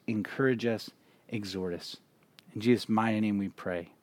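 soft, hushed pastor's prayer; quiet congregational prayer voice. Acted. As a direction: pray it low and earnest to God. Pastor leads an opening prayer.

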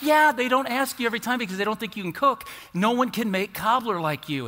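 convince her she's the best cook; eager reassurance. Retold or imitated. Son assuring his mom nobody cooks like her, eager.

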